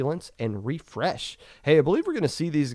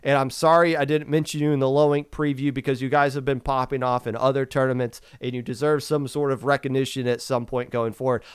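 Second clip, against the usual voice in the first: normal talking voice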